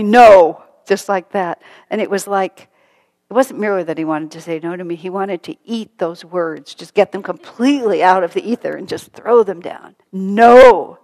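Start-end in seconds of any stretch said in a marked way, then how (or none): 0.0-0.6 loud, firm no; much louder emphatic no. Retold or imitated. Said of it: "he said quite emphatically". 10.1-11.0 loud, forceful no; much louder forceful no. Retold or imitated. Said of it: "he said very forcefully".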